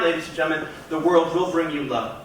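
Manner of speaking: normal talking voice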